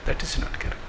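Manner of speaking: whispered, hushed firm verdict. Shown as a demonstration. Speaker rejecting the serial's portrayal, whispered. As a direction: breathe the verdict out. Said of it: "repeated verdict".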